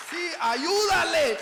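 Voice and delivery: laughing incredulous shout; amazed loud repeat. Retold or imitated. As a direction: shout the order back, half laughing. Repeats the command in disbelief.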